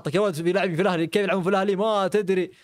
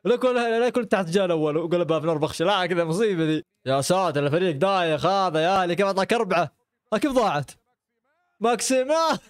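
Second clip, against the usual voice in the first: ordinary talk, nothing marked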